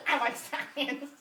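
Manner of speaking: slow, emphatic science-geek voice; Napoleon Dynamite. Retold or imitated. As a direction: imitate a geek, stretching the words out. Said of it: "Napoleon Dynamite".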